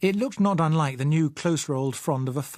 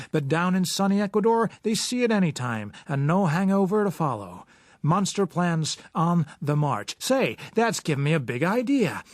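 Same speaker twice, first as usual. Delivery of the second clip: newsreel announcer; 6 semitones higher than usual. Acted. Newsreel commentator selling walking plants to movie audience, brash and jokey. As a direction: hype it up like a corny newsreel barker. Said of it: "the kind of accompaniment supposed to be on the level of the great movie-going public".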